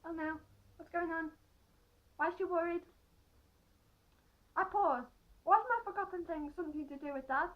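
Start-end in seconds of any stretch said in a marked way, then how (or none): none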